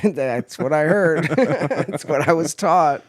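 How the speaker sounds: laughing. Real, not acted. Finishing the pink eye family joke, laughing slowly. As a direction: giggle out the punchline.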